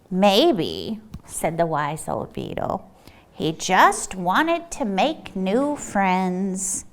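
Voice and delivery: put-on low, soft, slow old beetle voice; wise old beetle. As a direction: speak slowly like an old sage.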